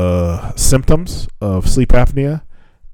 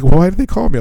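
higher-pitched puzzled question; puzzled translator; 11 semitones higher, faster than usual. Retold or imitated. Translator asks why they called her, puzzled. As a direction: mildly mimic a confused staffer.

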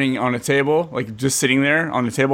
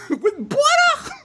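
very high, stretched-out outraged squawk; Ray impression; much slower than usual. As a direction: squeal it slowly in mock disbelief. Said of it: "That was a good Ray".

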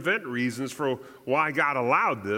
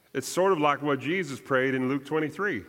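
ordinary talk

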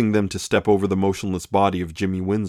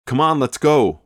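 higher, nervous whining plea; jittery henchman urging to leave; nervous whine; 6 semitones higher, 3 dB louder than usual. A narrator's reading. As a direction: tug him away, anxious. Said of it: "whined the man in ... brown coat nervously".

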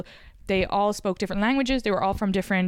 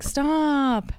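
put-on her old stop; 6 semitones higher than usual. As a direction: snap out "Stop" the way she used to. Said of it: "I'll always say".

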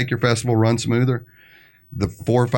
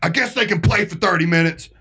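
high, aggrieved complaint without extra volume; mock yelling; 7 semitones higher than usual. Festival organizer conceding during an argument, high mock-exasperated yell. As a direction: imitate a worked-up man griping. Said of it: "they're yelling back and forth".

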